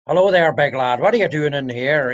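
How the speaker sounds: loud, forceful Belfast greeting; clipped Belfast accent. Retold or imitated. Belfast local greeting a stranger, clipped and forceful accent. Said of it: "that clipped thing".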